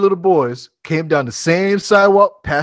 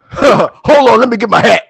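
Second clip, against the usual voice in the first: put-on eager old man; 5 semitones higher, 7 dB louder than usual.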